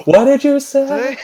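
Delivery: high, drawn-out comic "huh?" voice; oblivious character. Retold or imitated. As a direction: stretch it out in a high, clueless mock voice. Oblivious character missing telepathic talk.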